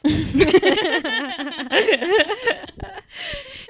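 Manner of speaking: high, loud burst of laughter; big laugh. Real, not acted. Cracking up at the turtle kid clip, loud high laughter. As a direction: let a full laugh burst out.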